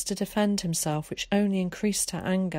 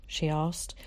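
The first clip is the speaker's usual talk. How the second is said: plain reading voice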